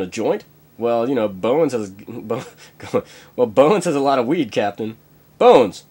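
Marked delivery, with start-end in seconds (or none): 5.4-5.8 loud call across the ship. Acted. Captain calls Bones over, loud call. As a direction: shout for the doctor.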